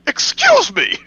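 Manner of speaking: high, loud mock outrage; mock offended. Friends riffing on a Pokemon clip, squealing in mock offence.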